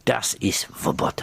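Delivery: slow, low German repeat; low, deliberate German accent. Acted. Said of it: "How's your German accent?".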